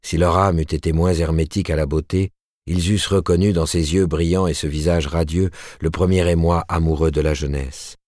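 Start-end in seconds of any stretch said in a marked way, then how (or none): none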